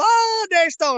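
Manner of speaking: high, loud, thrilled greeting; light up like you've spotted a celebrity; delighted recognition. Retold or imitated. Chris Flores spotting him a year later, high and delighted. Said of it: "And Keith goes".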